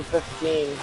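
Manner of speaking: normal talking voice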